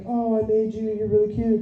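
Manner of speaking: hushed, tender mock coo; cooing parent. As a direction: imitate a parent doting on a baby. Parent cooing to held baby.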